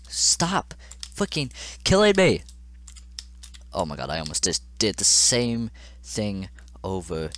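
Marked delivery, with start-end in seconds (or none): 0.1-2.4 high, loud, drawn-out outburst; frustrated yell. Real, not acted. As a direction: yell slowly at the game in rage. Rages at the game.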